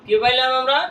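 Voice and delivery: ordinary delivery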